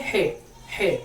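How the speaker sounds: हे breathed out from the throat, each one separate; throaty h. Shown as a demonstration. Teacher modelling the letter after explaining it, हे from the throat. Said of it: "हलक से आवाज आना चाहिए".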